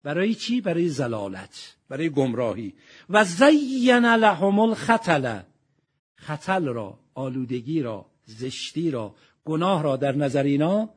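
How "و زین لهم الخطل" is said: slow, louder chanted verse; Quranic recitation. Acted. Preacher reciting the verse to listeners, slow chant.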